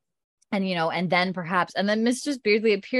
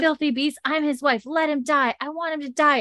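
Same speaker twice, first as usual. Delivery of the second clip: higher, hammed-up angry outburst; imitated rage; 7 semitones higher than usual. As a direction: spit it out like a furious wife. Enraged wife screaming at Claire.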